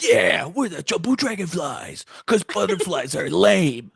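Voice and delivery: hammed-up gang member chiming in; background character. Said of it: "the fucking background character's like".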